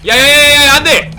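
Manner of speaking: shouting in panic, loud and high; panicked shout. Real, not acted. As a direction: yell as the character nearly dies. Near death in game, panicking.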